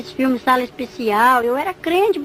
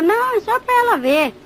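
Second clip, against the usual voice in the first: a much higher, louder devil brushing it off; 9 semitones higher, 3 dB louder than usual. Acted. The devil tells his demon she only came to look, high and loud.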